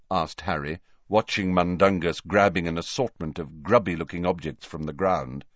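ordinary delivery, nothing marked

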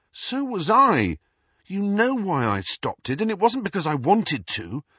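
high-pitched, wounded protest; heated defence. A narrator's reading. Harry defends the break-up to Ron, heated. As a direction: argue back with the pitch right up.

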